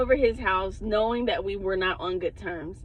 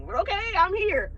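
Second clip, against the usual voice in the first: mock bravado; 9 semitones higher than usual. Retold bravado facing big guy.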